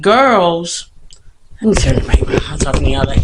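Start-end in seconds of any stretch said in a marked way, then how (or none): 1.6-3.2 a quick whispered aside while fiddling with the mic; hushed whisper, a bit lower. Real, not acted. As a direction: whisper it to yourself.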